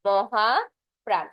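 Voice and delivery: drawn-out মহাপ্রাণ, said very slowly; teacher drawing out মহাপ্রাণ; slow emphasis. Shown as a demonstration. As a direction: stretch মহাপ্রাণ syllable by syllable. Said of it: "সেটা হয়ে যাচ্ছে".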